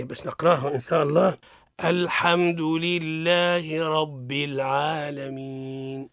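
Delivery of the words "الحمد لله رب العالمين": slow, measured Quran recitation. Acted. Teacher reciting الحمد لله رب العالمين for students. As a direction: recite each word evenly.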